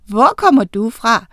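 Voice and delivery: henrykt, livlig tiltale; leende. A narrator's reading. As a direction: tal muntert til den lille fugl. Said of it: "lo Miranda". (højt, begejstret udbrud)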